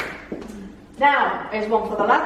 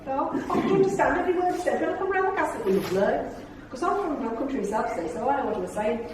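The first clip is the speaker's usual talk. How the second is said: mock Midlands accent; 3 semitones higher, 5 dB quieter, faster than usual. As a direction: imitate a chatty Midlands lady. Imitating Julie Walters' Midlands voice.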